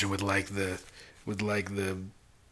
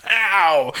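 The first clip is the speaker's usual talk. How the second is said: loud, high comic "pow"; playful burst, sharply louder; punch sound effect; 8 semitones higher, 9 dB louder than usual. Pretend. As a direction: punch it out like a cartoon hit. Imitated punch noise.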